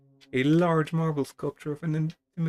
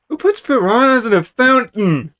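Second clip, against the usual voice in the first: loud, high cry of disbelief; shocked outburst; 9 semitones higher, 14 dB louder than usual. Real, not acted. Player yelling in disbelief as piranhas leap, loud and high. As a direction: yell it out in shock at the fish.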